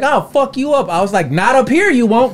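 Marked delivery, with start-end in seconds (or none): 0.0-0.9 much higher mock threat; heckler threat. Comedian imitating the heckler's threat. 1.3-2.3 higher, louder retort; defiant comeback. Retold or imitated. Comedian reenacting his retort to the heckler.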